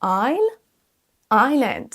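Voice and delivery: Isle as rising EYE-L, island as falling EYE-lənd; silent S. Shown as a demonstration. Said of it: "le S est silencieux". (Isle et island sans le S)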